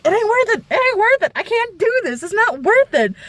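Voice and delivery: high, whining refusal; a high whine of giving up on the food; whiny give-up. Retold or imitated.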